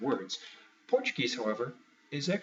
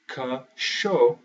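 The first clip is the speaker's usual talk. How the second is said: CACHORRO slowly, CHO punched loud; middle-syllable stress; 7 dB louder, much slower than usual. Shown as a demonstration. Teacher showing which beat gets the stress, loud middle syllable. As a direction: break up "cachorro", hit the middle CHO hard. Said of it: "that middle one".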